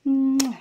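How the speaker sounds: loud smacking kiss. Real, not acted. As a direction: blow a big kiss. Give Mommy a kiss.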